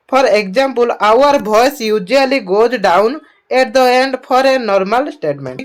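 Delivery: reads English very slowly; slow dictation reading. Acted. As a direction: spell it out slowly for students.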